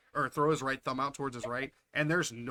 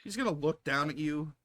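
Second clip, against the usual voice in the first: ordinary delivery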